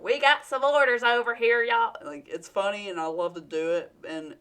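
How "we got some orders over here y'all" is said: exaggerated southern accent. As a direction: play up a Southern country accent for laughs. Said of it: "a silly joke with an obvious southern accent".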